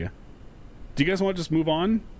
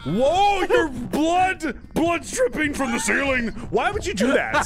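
panicking gamer; 7 semitones higher than usual. Real, not acted. Blood drips in horror game.

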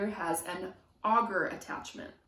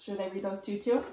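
ordinary delivery, nothing marked

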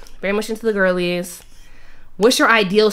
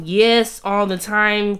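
usual speaking voice

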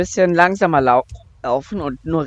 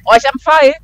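excited; 10 semitones higher, 11 dB louder, faster than usual. Real, not acted. Finds item in game, excited.

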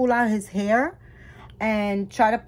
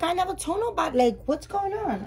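higher, baffled retelling of her own reply; shocked disbelief; 8 semitones higher than usual. Retold or imitated. To the angry seller, retelling her own stunned reply in a high voice. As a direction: act stunned by the accusation. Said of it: "I was like".

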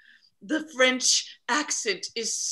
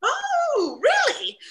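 thrilled squeal; 4 dB louder, slower than usual. Acted.

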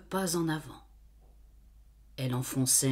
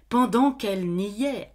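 usual speaking voice

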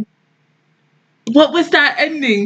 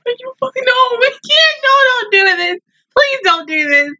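distressed pleading; 9 semitones higher than usual. Real, not acted. Viewer begs the show not to do this, distressed pleading. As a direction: plead in dismay, pitch raised.

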